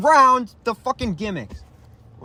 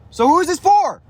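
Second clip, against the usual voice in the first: higher and louder, exasperated; heated question; 9 semitones higher, 5 dB louder than usual. Real, not acted. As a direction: demand it, fed up. Ranting about the event.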